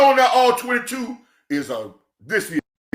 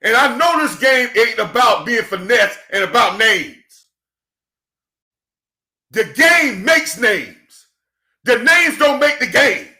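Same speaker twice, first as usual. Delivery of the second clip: preaching cadence; 3 semitones higher, 3 dB louder than usual. Acted. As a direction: preach it like a pastor to the congregation. Preaching to viewers, church.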